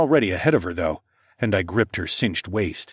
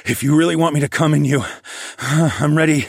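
raised, loud, heated voice; urgent, strained; 7 semitones higher, 6 dB louder than usual. A narrator's reading. Narrator to Kat near his peak, urgent. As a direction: push it out with a sigh midway.

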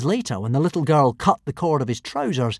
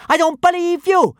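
put-on high, loud little-girl retort; fierce disbelief; 7 dB louder than usual. A narrator's reading. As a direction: throw it back loudly, pitched right up. Girl rejects Hiccup's claim in fight.

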